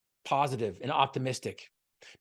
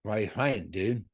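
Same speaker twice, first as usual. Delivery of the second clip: slurred speech; 2 semitones lower, much slower than usual. Retold or imitated. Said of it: "in his slurred speech".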